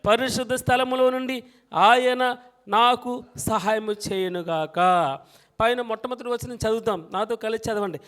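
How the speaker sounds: loud, ringing congregational recitation; preaching at full force. Acted. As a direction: lead the church in reading the verse, loudly. Leads congregation reading together.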